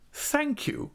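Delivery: high, gracious thanks; a grand lady's satisfied thanks; Mrs Micawber voice. A narrator's reading. Wife thanking Traddles, high and gracious.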